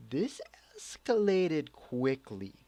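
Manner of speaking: slow, high squeaky start that drops; mock alarm. Vlogger reacting to girl's sudden threat, slow mock alarm. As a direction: act out stunned disbelief.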